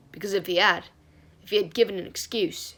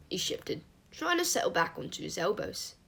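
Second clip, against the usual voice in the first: ordinary delivery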